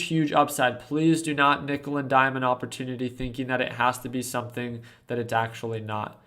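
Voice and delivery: ordinary talk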